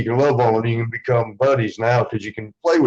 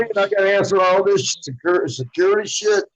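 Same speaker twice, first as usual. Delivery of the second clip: high, fed-up complaint; exasperated; 7 semitones higher than usual. Retold or imitated. A wrestler griping about answering the security questions. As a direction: gripe about security hoops. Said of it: "I'm like".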